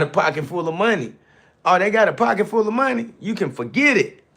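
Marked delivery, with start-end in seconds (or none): 1.6-4.2 scoffing. Acted. Mocking people with money.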